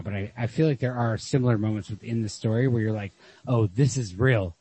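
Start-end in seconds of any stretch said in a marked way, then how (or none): none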